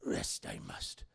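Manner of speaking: very low, slow, hoarse Yoda croak. Retold or imitated. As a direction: sink the voice down like a tired little old master. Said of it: "He's like".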